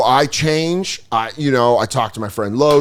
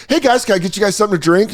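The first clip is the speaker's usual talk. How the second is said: fast, high-pitched server pitch; over-eager waiter addressing a table; waiter's greeting; 9 semitones higher, faster than usual. Acted. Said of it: "it was like four bros and I was like".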